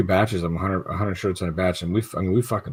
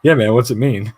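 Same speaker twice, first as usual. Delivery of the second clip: louder, egging-on buddy voice; a buddy stirring the pot, louder; teasing buddy; 4 semitones higher, 7 dB louder than usual. Retold or imitated. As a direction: needle your friend with a grin.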